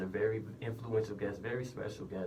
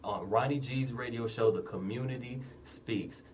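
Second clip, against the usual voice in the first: bright, loud radio opener; radio host voice; 4 semitones higher, 5 dB louder than usual. Acted. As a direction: greet the listeners like a DJ. Opens his radio show.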